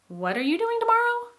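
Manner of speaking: high rising echo question, asking again in surprise. Shown as a demonstration. Teacher shows an echo question, high and rising. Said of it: "let me give you the example".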